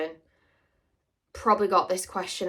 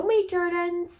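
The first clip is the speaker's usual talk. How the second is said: high, slow Somerset character voice with a lisp; Terence voice; 10 semitones higher, slower than usual. Shown as a demonstration. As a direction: put on Terence's odd Somerset lisp, slowly. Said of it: "Terence talks like that".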